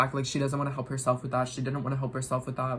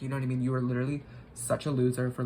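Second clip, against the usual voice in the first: ordinary talk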